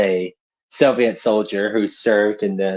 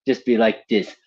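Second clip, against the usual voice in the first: usual speaking voice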